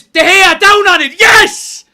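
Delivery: screaming high with relief; ecstatic shout. Real, not acted. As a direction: yell it out in delight. Keeper saves in final seconds.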